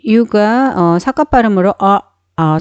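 ordinary talk, nothing marked

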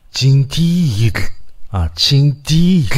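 징디윷 sounded out slowly; Navajo pronunciation. Shown as a demonstration. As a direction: model 징디윷 drawn out. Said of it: "이 발음이거든요".

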